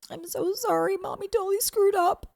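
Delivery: a hint of soothing a crying child; apologetic mother. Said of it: "I'm holding you and I'm like".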